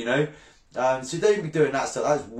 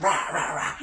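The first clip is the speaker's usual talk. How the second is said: higher-pitched "rah rah rah" jabber; mock ranting noise; 4 semitones higher, slower than usual. Fisherman showing how he goes at trolls, mock ranting. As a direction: imitate yourself mouthing off in rapid noise. Imitating himself going at trolls.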